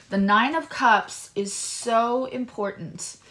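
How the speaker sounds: slow, deliberate, each word laid down; slow emphatic restatement. Real, not acted. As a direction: spell it out slowly for emphasis. Restated slowly after commercial break.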